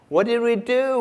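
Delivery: put-on high, loud protest from a bullied child; indignant demand. A narrator's reading. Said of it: "we demanded".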